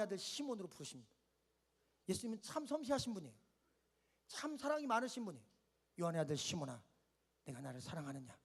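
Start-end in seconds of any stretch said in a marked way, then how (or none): 6.0-8.4 much lower, very soft tender question; soft, low, loving voice of Jesus. Acted. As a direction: hush it down to a gentle ask.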